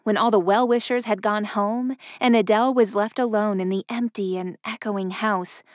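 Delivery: ordinary delivery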